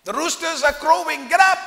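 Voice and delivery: David mockingly waking Saul from the hilltop; shouted wake-up call. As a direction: yell across the hills like a rooster. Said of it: "he shouts".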